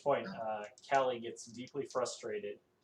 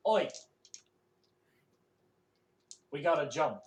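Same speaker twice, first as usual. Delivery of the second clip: urgent call; 4 semitones higher, 8 dB louder, faster than usual. Acted. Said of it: "looks to Callie and goes".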